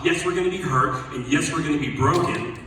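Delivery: normal talking voice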